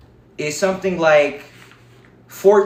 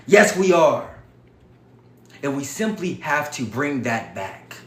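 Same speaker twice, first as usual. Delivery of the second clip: ordinary delivery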